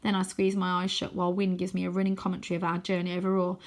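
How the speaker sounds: ordinary delivery, nothing marked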